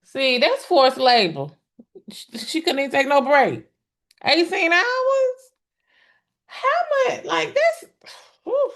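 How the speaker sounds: stunned outrage at eighteen hours; shocked disbelief. Real, not acted. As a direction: voice shooting up in disbelief. Shocked reaction to eighteen-hour ordeal.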